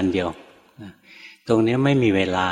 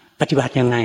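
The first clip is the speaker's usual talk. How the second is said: slightly raised, louder mock demand; retold stern challenge; 3 semitones higher, 4 dB louder than usual. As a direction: imitate an elder monk's pointed question. อาจารย์ชี้หน้าถามห้วนๆ. (เลียนเสียงอาจารย์ถามคาดคั้น)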